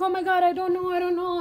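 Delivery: a frightened husband dodging the question; scared denial. Retold or imitated. Guilty husband tells his wife he doesn't know, nervously. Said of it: "he was scared to say what happened".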